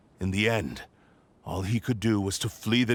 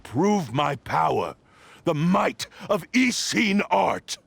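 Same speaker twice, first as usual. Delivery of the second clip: rising proud boast; 8 semitones higher, 4 dB louder than usual. Acted. Possessed sword boasting its might to Kazuha, triumphant swell. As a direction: climb higher and louder to a triumphant peak.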